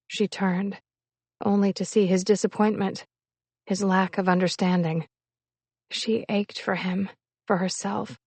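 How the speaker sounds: ordinary delivery, nothing marked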